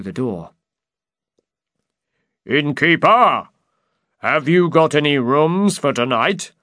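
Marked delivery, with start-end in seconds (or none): 2.4-6.5 loud, high booming call; shouted call. Acted. Big man shouts to the innkeeper for rooms. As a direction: boom across the room. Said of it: "the man shouted".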